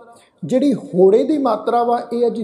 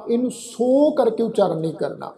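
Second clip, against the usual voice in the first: normal talking voice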